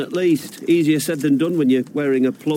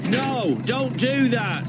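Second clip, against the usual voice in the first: high, drawn-out protest; panicked plea; 8 semitones higher, slower than usual. Acted. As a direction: plead slowly in alarm, pitched high. Pleads as tree is threatened.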